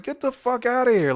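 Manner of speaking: high-pitched mock dismissal; incredulous bartender. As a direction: imitate an appalled bartender. Bartender telling him to leave.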